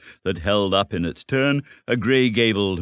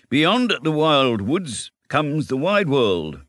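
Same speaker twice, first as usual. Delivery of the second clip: slow, louder, solemn warning; ominous pronouncement; 9 semitones higher, 4 dB louder, slower than usual. Acted. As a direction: say it slowly like a grave omen. Rat warning about the world.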